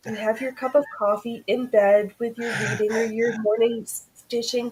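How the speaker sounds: ordinary talk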